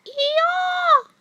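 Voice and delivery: shrieking loud high eeyore; loud high mock peacock screech; peacock call. Shown as a demonstration. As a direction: cry out like a peacock. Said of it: "That's Mommy's favorite".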